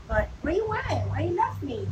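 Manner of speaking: high, swooping playful plea; playful calling. Pretend. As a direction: call after a pet in a high voice. Playfully asks her wandering dog.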